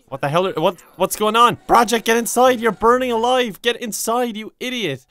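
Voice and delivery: high, loud frantic shout at the Sim; panicked yelling. Real, not acted. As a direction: yell him back inside before he burns.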